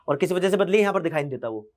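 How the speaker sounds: ordinary delivery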